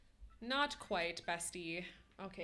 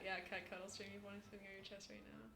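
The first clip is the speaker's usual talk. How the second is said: usual speaking voice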